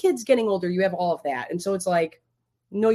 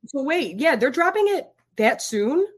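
surprised; 6 semitones higher, 3 dB louder, slower than usual. Real, not acted. Surprised at release date.

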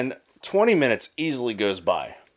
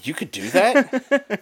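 high, drawn-out incredulous question; mock amazement; 9 semitones higher, 3 dB louder, much slower than usual. Caller hears scammer can cancel his number, mock amazed. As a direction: play up delighted disbelief, stretched out.

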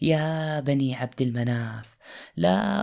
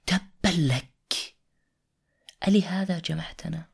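scornful quote; 3 semitones higher than usual. Acted. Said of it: "وقف أبو لهب".

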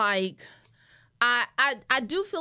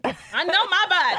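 put-on louder, insistent little-girl voice; mock childish insistence, with a laugh; Olivia from the Cosby Show; 7 semitones higher, 7 dB louder than usual. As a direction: stomp it like a stubborn child on a sitcom. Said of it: "I turned into, like, Olivia from the Cosby Show".

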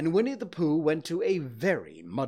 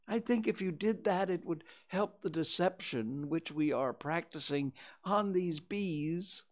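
a worried bear dangling from a balloon; Pooh voice; 8 semitones higher than usual. Acted. Pooh asks for umbrella.

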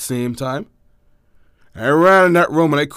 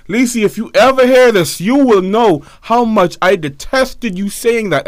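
higher, louder, slowing on the complaint; mock-accusing call-out; 7 semitones higher, 4 dB louder than usual. Real, not acted. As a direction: call out to the sister with playful outrage. Addresses sister directly, exaggerated.

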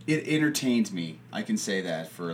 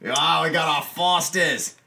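higher, hammed-up Aussie voice; Australian accent; 4 semitones higher, 4 dB louder than usual. Host shows off the beer, mock Australian accent. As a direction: put on a playful Australian twang. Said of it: "the Australian accents today".

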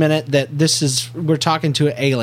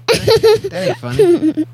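high, loud squeaky protest; mock offended; 5 dB louder, slower than usual. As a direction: play hurt, laughing. Mock offense at child laughing.

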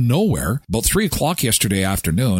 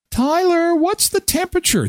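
much higher mock wife voice; imitating wife. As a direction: play the wife asking sweetly. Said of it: "That's code for turn up the furnace".

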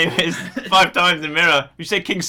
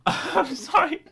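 squeaky apology broken by laughter; laughing apology; 11 semitones higher, much slower than usual. Real, not acted. Apologising to his friend while laughing. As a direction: laugh through the sorry.

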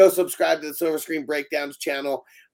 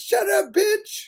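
much higher squeaky mock retort; mock snapped reply; much slower than usual. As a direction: fling it back in a high put-on voice. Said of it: "funny conversation".

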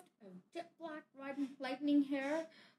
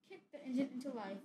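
usual speaking voice

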